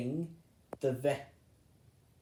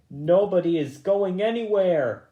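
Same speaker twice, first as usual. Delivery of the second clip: mock growl; 6 semitones higher, 8 dB louder than usual. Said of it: "growled Bigwig".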